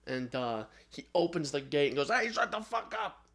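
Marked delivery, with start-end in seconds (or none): 2.1-3.2 high, put-on irritated yell; mock angry yell. Villain yelling into the gate at the noise, mock angry yell.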